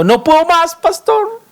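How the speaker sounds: lamento agudo de feligrés agotado, mucho más alto; quejido imitado. Retold or imitated. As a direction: imita a alguien que ya no aguanta, quejándose al pastor. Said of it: "he oído a algunos de ustedes gritando". (feligrés quejándose al pastor, voz aguda y lastimera)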